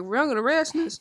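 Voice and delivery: high, loud, eager question; imitating excited baby. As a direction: act out a baby suddenly perking up. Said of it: "that baby turned straight around like".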